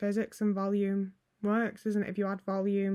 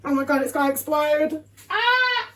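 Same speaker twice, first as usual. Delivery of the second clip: high, loud alarm rising into a scream; panicked; 5 semitones higher, 5 dB louder than usual. Real, not acted. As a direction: cry out as if it's about to blow. Frightened the jar will explode.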